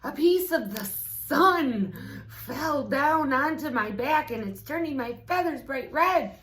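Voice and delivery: high, loud goose in a panic; silly goose's alarmed squawking voice; frantic goose voice. A narrator's reading. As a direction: tell the rooster the sky is falling. Goose voice continues.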